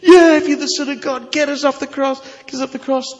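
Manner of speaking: high, loud jeer with a laugh in it; a dying criminal taunting Jesus, laughing scornfully; mocking curse. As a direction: sneer at him, daring him to save himself. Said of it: "who's cursing him".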